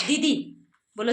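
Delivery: usual speaking voice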